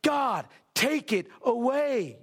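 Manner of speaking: high, drawn-out desperate plea; impassioned slow pleading as Paul. Acted. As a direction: cry out to God slowly, word by word. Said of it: "Paul's pleading this. He's like".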